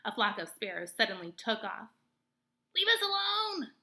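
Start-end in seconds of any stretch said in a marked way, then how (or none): 2.7-3.7 high squeaky cry; squeak. A narrator's reading. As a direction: squeak it out in fright. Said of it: "squeaked Dart".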